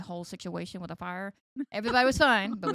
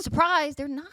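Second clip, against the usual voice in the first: high, loud teasing "Surprise"; spring a fake twist on them; playful fake announcement; mock surprise reveal; 8 semitones higher, 10 dB louder than usual. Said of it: "No, I'm just kidding".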